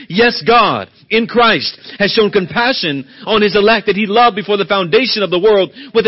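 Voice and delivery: loud, rising preacher's cadence; preaching at full force. Acted. Preacher pressing the congregation on God's mercy, preaching hard. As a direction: drive every line home to the pews.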